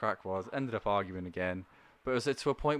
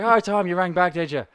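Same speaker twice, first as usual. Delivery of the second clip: loud, higher mocking Irish caller; sarcastic caller; 7 semitones higher, 12 dB louder than usual. Irish caller sarcastically mocking the agent, in a loud sneer.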